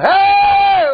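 loud high call into the woods; lost kid calling loudly for help; retold yell. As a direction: shout out for anyone nearby. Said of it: "We start yelling".

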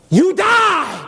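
high, punched cry of victory; gloating devil. Acted. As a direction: crow it as the triumphant tempter.

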